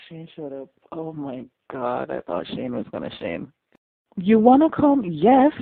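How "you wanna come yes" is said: loud, high, eager cry; excited outburst. Real, not acted. Viewer reacting to an invitation, excited outburst.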